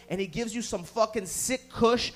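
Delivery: ordinary talk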